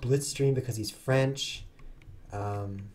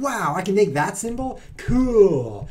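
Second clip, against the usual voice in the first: mock amazement; 8 semitones higher, 7 dB louder, slower than usual. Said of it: "I'm like".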